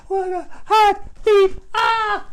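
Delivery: high, loud sobbing words; little kid crying out about his feet; crying child. Retold or imitated. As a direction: blubber through the pain. Burned child answering sister.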